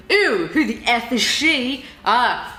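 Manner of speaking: ordinary delivery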